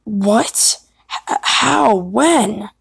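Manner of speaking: stammered, swooping disbelief; startled questions. Acted. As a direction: fire off three shocked questions. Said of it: "I'll do more alternatives".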